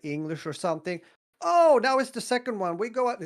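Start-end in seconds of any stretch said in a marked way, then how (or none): 1.4-3.2 high, loud eager call; rally the class out; excited call. Retold or imitated. Teacher to pupils, high excited call to go out. Teacher calling the second run.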